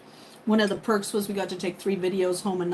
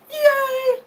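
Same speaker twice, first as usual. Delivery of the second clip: high cheer; 5 dB louder than usual. Real, not acted. Celebrating partnership.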